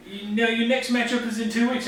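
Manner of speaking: high-pitched New Zealand caricature; New Zealand accent. Acted. Host telling the team about their next match, put-on New Zealand accent. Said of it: "doing my best New Zealand accent".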